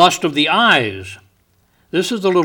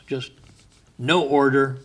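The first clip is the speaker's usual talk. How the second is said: ordinary talk, nothing marked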